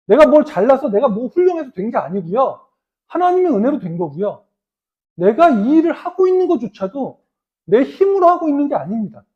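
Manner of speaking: loud and high, impassioned Paul; fervent preaching. Retold or imitated. Preacher voicing the apostle Paul to his congregation, loud and fervent. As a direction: declaim Paul's words at full force. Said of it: "사도 바울이 이야기하잖아요".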